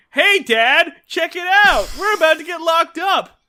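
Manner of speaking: put-on high, loud excited kid; Opie. Kid showing dad he'll be locked up, excited yell. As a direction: call out to Dad in mock childish glee. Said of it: "Opie says".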